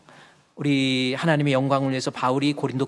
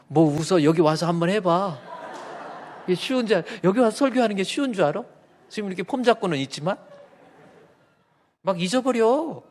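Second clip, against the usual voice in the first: higher, louder, laughing protest; mock indignant; 6 semitones higher, 4 dB louder than usual. Real, not acted. Pastor teasing congregation who laughed at his slip. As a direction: playfully scold the laughing crowd. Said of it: "laughing at congregation".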